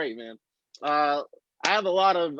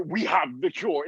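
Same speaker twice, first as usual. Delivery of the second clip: put-on Nigerian accent; 3 semitones lower than usual. Doctor in a movie scene, imitated Nigerian accent. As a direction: lower mock Nigerian accent. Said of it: "trust that accent with that message".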